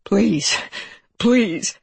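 acted desperate pleading; shuddering plea. A narrator's reading. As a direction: beg in fear with a shudder. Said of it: "he said in a shuddering voice".

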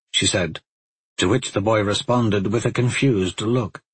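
plain reading voice

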